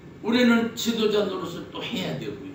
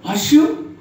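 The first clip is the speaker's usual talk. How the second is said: English as heard; 3 semitones higher, 9 dB louder than usual. Retold or imitated. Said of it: "미국 사람의 귀에는".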